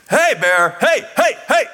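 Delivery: calling out to scare a bear; loud shooing calls; scaring off a bear. Retold or imitated. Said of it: "starts waving his arms".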